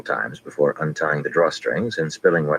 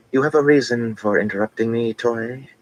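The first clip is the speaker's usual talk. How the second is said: cool tones; 8 semitones higher than usual. A narrator's reading. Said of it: "Tuon said in cool tones".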